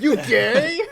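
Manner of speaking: high, loud mock jeer; put-on pub bully taunt; bar bully. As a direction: shout it like a heckling lout. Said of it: "like, assholes come over".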